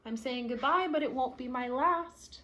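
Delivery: ordinary delivery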